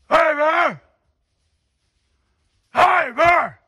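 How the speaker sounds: loud high yell to scare off a bear; scaring off a bear. Real, not acted. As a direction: shout to drive the bear off. Hiker yelling at bear.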